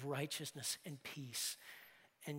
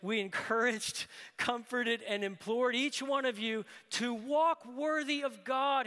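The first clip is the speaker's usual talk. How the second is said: louder and higher, driving the three verbs home; preached in full; 6 semitones higher, 7 dB louder, slower than usual. Shown as a demonstration. Preacher rereading the verse to the congregation, loud and urgent. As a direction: throw the words out to the room. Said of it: "Preachers love groupings of three".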